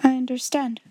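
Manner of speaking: ordinary delivery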